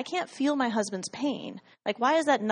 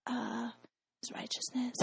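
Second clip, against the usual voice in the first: tiny, softer whispered answer; sheepish whisper; 13 dB quieter than usual. Retold or imitated. Answering the Lord's question, hesitant whispered reply. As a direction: half-whisper it, unsure it's a trick question. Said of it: "And I was like".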